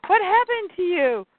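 shocked wife. Retold or imitated. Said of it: "And Mira turns around and goes".

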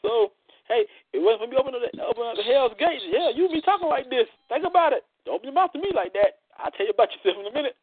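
high-pitched mock scolding; hammed-up back talk, pitched high; put-on sassy telling-off. Host playfully warning "don't open your mouth", high sassy voice.

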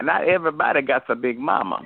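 ordinary talk, nothing marked

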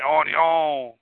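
Cajun TV cook's catchphrase; mock Cajun catchphrase voice; Justin Wilson. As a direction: imitate a Cajun cook's signature word. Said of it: "he would always say".

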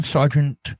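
ordinary delivery

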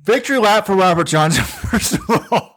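talking through laughter; real laughing. Real, not acted. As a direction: crow it while cracking up. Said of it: "I'm laughing so hard".

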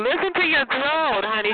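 ordinary talk, nothing marked

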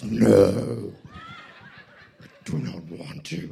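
very low, breathless grudging no; grumpy refusal. Acted. Hot husband refusing wife, low sulky whisper.

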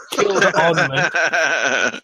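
slow, laughing through the words. Real, not acted. Gamer retelling his follower's rampage, laughing slowly. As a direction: stretch it out while laughing.

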